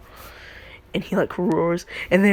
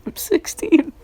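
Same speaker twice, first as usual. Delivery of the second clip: high-pitched tearful whine, put on; mock crying; 11 semitones higher, 4 dB louder than usual. Deku mistaken for a kindergartner, mock crying protest. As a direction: squeal it as a pretend crybaby. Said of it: "Just, like, crying".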